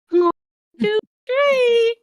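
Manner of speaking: very high puppet voice. Acted. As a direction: squeal it as a smitten puppet. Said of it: "you change the puppet".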